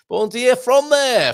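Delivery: slow, loud, high Aussie drawl; mock Australian accent. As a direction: stretch it out like a hammed-up Aussie. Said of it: "They did talk like that in Australia".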